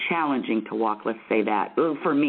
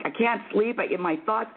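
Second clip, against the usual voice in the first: higher-pitched, mildly whiny retort; fretful protest; 7 semitones higher than usual. Retold or imitated. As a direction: protest like someone worn out by sleeplessness. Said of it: "And I'm like".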